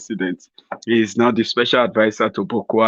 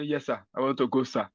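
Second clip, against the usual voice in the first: humble aide; 10 dB quieter than usual. Pretend.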